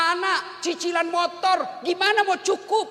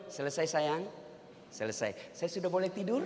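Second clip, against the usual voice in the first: soft, low, sweet-talking husband; placating husband; 10 semitones lower, 11 dB quieter than usual. Acted. Husband to wife once her nagging is over, soft and placating. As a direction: ask it gently, voice lowered right down.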